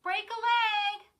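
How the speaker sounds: high, loud call across the stage. Acted. Sister calling good luck across backstage, high and loud. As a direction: throw it across the room. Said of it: "Lindy called across to her".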